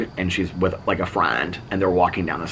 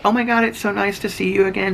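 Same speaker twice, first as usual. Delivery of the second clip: high gushing greeting; lightly voiced gushing woman; gushing neighbor; 12 semitones higher than usual. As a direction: imitate a delighted neighbor.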